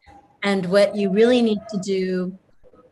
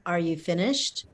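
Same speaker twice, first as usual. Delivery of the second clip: quiet, calm question, a little lower; 3 semitones lower, 6 dB quieter than usual. Shown as a demonstration. To a customer who just finished yelling, very calm. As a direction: ask it evenly, no heat at all. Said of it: "you can very calmly say".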